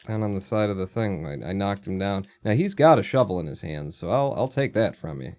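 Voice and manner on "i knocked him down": usual speaking voice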